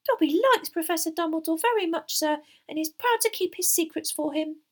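high, squeaky, earnest elf voice; high-pitched devoted elf; loyal house-elf. Acted. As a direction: declare it proudly as a tiny elf.

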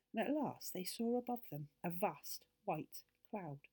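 ordinary delivery

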